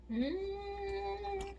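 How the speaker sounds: soft high hum of pleasure; savoring hum. Real, not acted. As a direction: hum over a tasty bite. Tasting food on camera.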